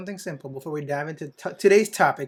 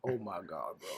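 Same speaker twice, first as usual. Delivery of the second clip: low mock awe; 9 semitones lower, 15 dB quieter than usual. Mocking friend's reaction.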